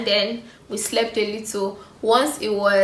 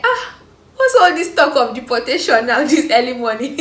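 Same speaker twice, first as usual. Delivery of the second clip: laughing; 4 semitones higher, 7 dB louder than usual. Real, not acted. Laughing at deportation talk.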